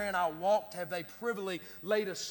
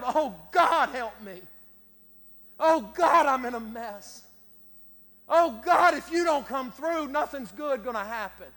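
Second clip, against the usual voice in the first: crying out loud; 3 semitones higher, 5 dB louder than usual. Shown as a demonstration. Preacher showing the congregation a loud desperate cry to God. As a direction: beg God aloud, voice rising on each Oh God. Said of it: "cry out loud".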